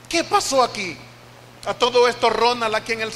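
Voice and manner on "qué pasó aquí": mildly raised, re-enacted plea with a falling end; crying out to God. Grandfather crying out to the Lord beside the baby, insistent plea. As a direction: cry it out a little more insistently. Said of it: "empecé a clamar al Señor".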